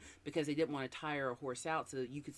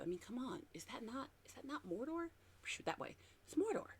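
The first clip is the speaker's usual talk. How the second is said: awed; 10 semitones higher, 6 dB quieter than usual. Real, not acted. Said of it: "awed at the landscape photo".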